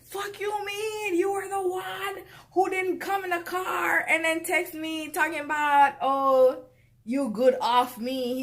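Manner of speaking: high, heated retort with a shout; angry comeback. Retold or imitated. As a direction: snap back at him, outraged. Angry comeback in the retold quarrel.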